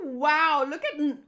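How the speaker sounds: ordinary delivery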